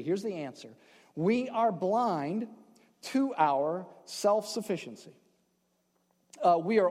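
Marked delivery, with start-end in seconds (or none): 1.1-5.1 drawn out, word by word; slow emphatic point. Real, not acted. Preacher slows down to stress his main point. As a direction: let each word land slowly.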